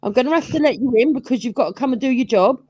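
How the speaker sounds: put-on high, fussy old woman; lightly voiced anxious old mum. Voicing seventy-year-old mum.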